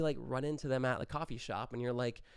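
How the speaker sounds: usual speaking voice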